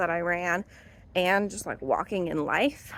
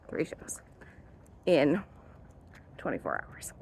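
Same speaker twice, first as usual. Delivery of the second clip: whispered; 5 semitones lower, 5 dB quieter than usual. Real, not acted. Stressing her own workload.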